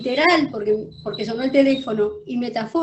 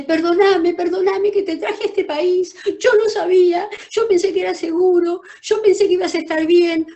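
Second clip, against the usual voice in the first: higher, louder anguished apology; a retelling of her mother's tearful cry; mother's crying plea; 7 semitones higher, 6 dB louder than usual. Retold or imitated. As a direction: plead as a desperate mother. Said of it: "y grita, y llora".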